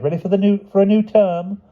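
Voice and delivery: very high, eager teacher's call; crying out. A narrator's reading. Miss Halibut asking children about new term, crying out. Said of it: "she cried".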